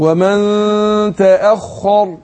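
slow recited ومن تأخر with long vowels; teacher's slow model recitation. Shown as a demonstration. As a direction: show the student how to chant it slowly. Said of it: "اما اقول ايه".

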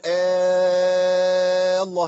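آه الله slow, the hamza turned into a long alif; ibdal with long alif. Shown as a demonstration. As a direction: stretch آه into a full alif before الله. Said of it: "إيه الإبدال ده؟".

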